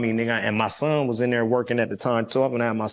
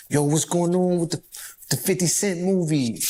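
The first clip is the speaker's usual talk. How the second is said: put-on light New York accent, a bit higher; 5 semitones higher than usual. To his homie on the phone, asking in a New York accent. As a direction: slip into a casual New York accent for the question. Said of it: "I had to use my New York accent".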